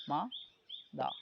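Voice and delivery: "মা, দা" as two separate beats; syllable by syllable. Shown as a demonstration. Teacher demonstrating syllables to students, "মা, দা" with a gap between. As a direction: pause between মা and দা. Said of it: "এই একবারে এটা উচ্চারণ".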